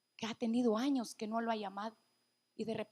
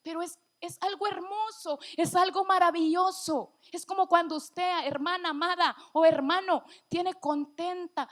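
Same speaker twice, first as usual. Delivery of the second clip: raised, higher preaching voice, moved; emocionada al predicar; 4 semitones higher, 5 dB louder than usual. Real, not acted. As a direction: swell with emotion as you testify to the congregation. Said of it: "disculpe que yo me emocione tanto". (con emoción, alzando la voz)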